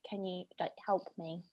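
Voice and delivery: very soft, low retold plea; discreet, helpless aside; hushed plea. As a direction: ask a neighbour for help under your breath. Retelling plea to neighbour mid-lecture.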